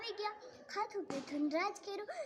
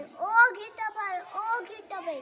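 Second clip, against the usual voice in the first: calling out; 4 semitones higher, 10 dB louder than usual. Acted. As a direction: draw out the first call, then ease off. Hiding rabbit calls to sheep.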